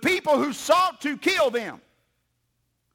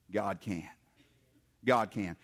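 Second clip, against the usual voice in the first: soft, low-spoken answer; quiet affirmation; 14 semitones lower, 9 dB quieter than usual. Real, not acted. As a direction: let it drop to a hush of conviction. Preacher drops to quiet affirmation.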